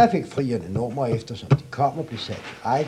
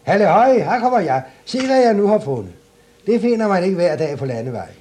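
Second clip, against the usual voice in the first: high and loud, quicker at the end; loud proud calling; 8 semitones higher, 8 dB louder than usual. Acted. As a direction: shout out the treasure proudly. Klods Hans shows the clog.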